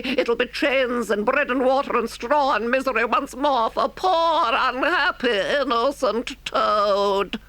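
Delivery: high-pitched, theatrical supplication; self-important toad crying for mercy; pleading Toad. A narrator's reading. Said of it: "raising his clasped paws in supplication, cried".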